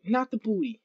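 muttering the same protest again quietly; muttered repeat. Real, not acted. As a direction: say it again under your breath, deflated. Repeats it under breath.

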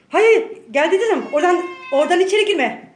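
loud, sharp, high telling-off; scolding the cat. Real, not acted. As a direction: order the cat back, fast and loud. (kediyi yüksek sesle azarlıyor)

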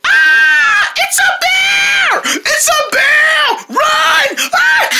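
very high, stretched-out screams; play-acted panic. Turtles running from the bear, panicked. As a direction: scream and flee in cartoon terror.

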